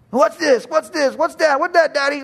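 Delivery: put-on high, piping kid voice; imitating a small child. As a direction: play a curious little kid pestering daddy. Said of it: "Aren't kids just full of questions".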